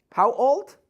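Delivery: put-on high, loud incredulous how old; mock disbelief. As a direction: demand it in fake outrage. Mock shocked parent.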